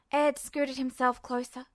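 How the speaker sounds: usual speaking voice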